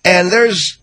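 ordinary talk, nothing marked

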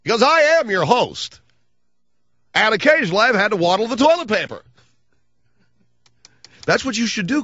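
high cartoonish mock voice; Comb Over Boy voice. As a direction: ask it in the silly high voice. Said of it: "a voice like it's completely out of a cartoon".

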